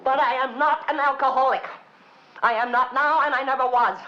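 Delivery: loud, high, emphatic protest; indignant denial. Acted. Denying to Tobias she's an alcoholic, loud and indignant. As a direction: insist hard, pushing every word out.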